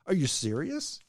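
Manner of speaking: ordinary delivery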